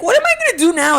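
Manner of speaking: high, loud frantic wail; hammed-up high-pitched despair; mock panic. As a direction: wail it like everything is ruined. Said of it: "And it's like".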